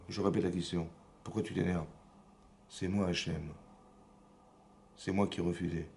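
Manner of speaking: low, softly spoken rebuke; quiet stern reply. Acted. God answering an indignant Cain, quiet and stern. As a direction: answer low and measured, repeating the question.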